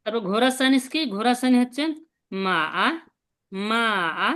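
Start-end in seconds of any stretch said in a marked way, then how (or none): 2.4-4.3 মা আ dipping low then rising; মা আ drawn out, dipping low; third tone. Shown as a demonstration. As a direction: dip the voice on মা আ like a Chinese third tone. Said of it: "এটা তিন নম্বরটা".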